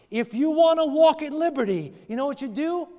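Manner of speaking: loud, high call to the congregation; rally the room before the cry; preaching at full force. Acted. Preacher builds again.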